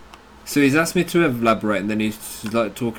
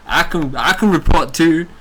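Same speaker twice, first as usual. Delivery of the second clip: loud, high boast; gloating taunt; 5 semitones higher, 5 dB louder than usual. Real, not acted. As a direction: crow about it. Taunting Alex after report.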